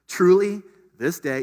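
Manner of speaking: truly this day hit high and loud, each word pressed hard; emphasis on truly. Acted. Pastor repeating Jesus' words with strong emphasis. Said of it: "I'm emphasizing this".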